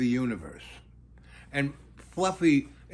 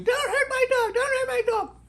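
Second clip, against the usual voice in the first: much higher put-on voice, HURT leaning down each time; hammed-up squeal begging him to spare the dog; high mock wail of the owner while he pries Fluffy off; mock screaming woman. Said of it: "the woman's screaming".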